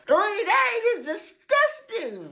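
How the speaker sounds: appalled at going three days unbrushed; laughing disgust. Real, not acted. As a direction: drag it out, appalled. Three days without toothbrush.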